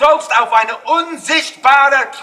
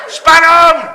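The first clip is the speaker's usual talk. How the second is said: loud, higher showman's shout; shouted cue; 4 semitones higher, 6 dB louder than usual. Shown as a demonstration. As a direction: shout the drum-roll cue. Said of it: "Ich schreie Spannung".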